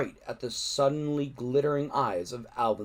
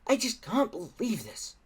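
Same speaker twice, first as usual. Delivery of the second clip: moaning Fishlegs; 9 semitones higher than usual. A narrator's reading. Fishlegs to his friends, moaning high. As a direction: moan it out, scared stiff. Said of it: "moaned Fishlegs".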